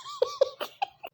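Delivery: high helpless burst of giggles; laughing fit. Real, not acted. Vlogger cracks up after mispronouncing soup, giggling. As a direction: crack up at her own slip. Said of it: "इतना हंसी हूँ ना".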